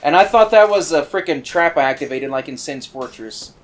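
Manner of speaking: normal talking voice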